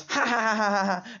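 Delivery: high, taunting fake laughter; mock mocking laugh. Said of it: "He goes".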